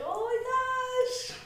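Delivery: very high, soft, drawn-out squeal; quiet mock panic, stretched slowly; thin high wail of dismay, acted; retold dread. Retold dread, scared to tell.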